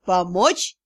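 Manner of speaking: low, scornful mocking question. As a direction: sneer the question back. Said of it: "насмешливо вопросил вампир".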